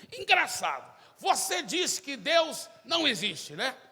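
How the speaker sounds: high, loud and slow challenge; bold challenge. Acted. Zé confronts the student president, loud and slow. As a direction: call him out boldly before everyone.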